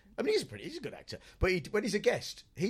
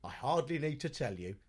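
low, soft, dramatic pauses between phrases; mock piece to camera, lowered and suspenseful; portentous TV reporter; 7 semitones lower, 6 dB quieter than usual. Imitating an insect reporter revealing his location, portentous.

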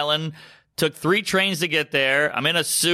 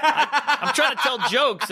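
laughing; 10 semitones higher than usual. Real, not acted. Laughing while retelling.

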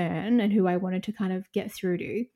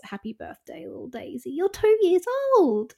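mummy voice; 8 semitones higher than usual. Real, not acted. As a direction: coo to your puppy like a mum. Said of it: "We'll get rid of the mummy voice now".

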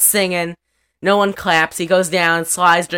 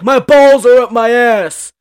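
loud, higher mock complaint; imitating the cowboy; 7 semitones higher, 11 dB louder than usual. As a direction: blurt it out like a hammed-up cowboy. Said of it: "He's like".

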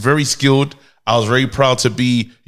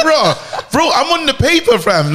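excited; 12 semitones higher than usual. Retold or imitated. Retold excited reaction to script.

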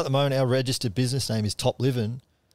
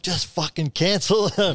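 exasperated; 7 semitones higher, 3 dB louder, slower than usual. Retold or imitated. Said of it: "I'm like".

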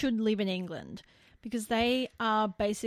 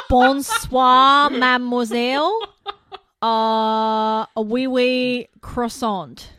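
put-on much louder laughing French accent, slow at the end; mock French; 3 semitones higher, 13 dB louder, slower than usual. Host imagining a French bogan greeting a lady in a loud French accent. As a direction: ham up a cartoon French flirt. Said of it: "that's what I imagine".

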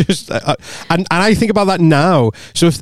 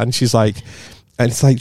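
ordinary talk, nothing marked

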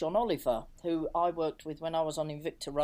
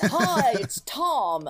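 slow, louder, higher put-on American caller voice; 5 semitones higher, 8 dB louder, much slower than usual. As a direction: draw out the greeting like a laid-back New Yorker on the phone. Phone call, [name] introduces himself.

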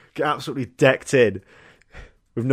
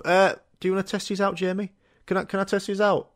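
shop voices; 7 semitones higher than usual. Acted. Voices mum and child in shop.

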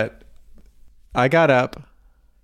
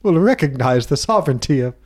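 Commander Sisko voice; 3 semitones higher than usual. Retold or imitated. Imitating Sisko's speech.

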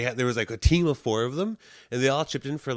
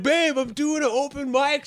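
put-on whiny boyfriend; 14 semitones higher, 4 dB louder than usual. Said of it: "And you're like".

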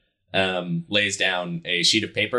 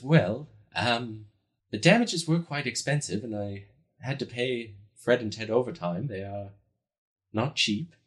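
measured innkeeper woman; 3 semitones higher than usual. Acted. Bridget weighing the adventurer's offer, measured and hesitant. Game master plays Bridget.

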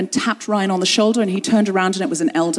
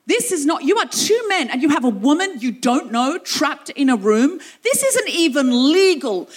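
indignant outburst; 5 semitones higher than usual. Retold or imitated. Woman demanding the elders unlock the door, indignant. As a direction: stand your ground and scold them.

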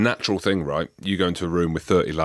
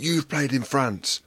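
slow, higher, scornful coach voice; scolding coach; 7 semitones higher, slower than usual. Retold or imitated. Coach accusing the player over breakfast, slow and scornful. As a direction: drag it out like a contemptuous coach. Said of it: "He's like".

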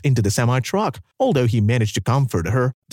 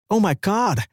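exclaimed; 7 semitones higher than usual. A narrator's reading. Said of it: "he exclaimed".

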